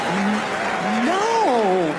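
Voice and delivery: long, swooping playful no; coy unmeant no. Acted. Woman afraid of being judged, drawn-out coy refusal. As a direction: refuse teasingly, meaning yes. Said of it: "that's not a fucking no".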